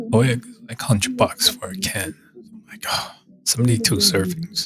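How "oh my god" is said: hushed high gasp at the price; breathy gasp of shock. Real, not acted. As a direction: gasp quietly at the cost. Price of the whole egg.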